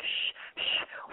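soft pretend shushing. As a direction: hush him with a long shh. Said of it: "you would have been like".

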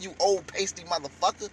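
normal talking voice

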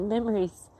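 ordinary talk, nothing marked

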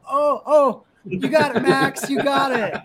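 high, loud cheer of a proud mom, with a laugh; imitating his wife cheering him on from the stands; excited cheering. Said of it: "she goes".

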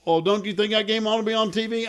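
high, loud Texas caller; Texas accent. Retold or imitated. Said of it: "I'm doing a Texas accent".

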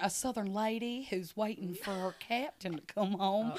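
Southern lady. Shown as a demonstration. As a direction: play a Southern lady pining for her captain. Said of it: "I do, like, a southern".

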